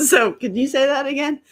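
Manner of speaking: high, loud, laughing question. Real, not acted. Asking to hear it again after getting distracted, laughing. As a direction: laugh through the request.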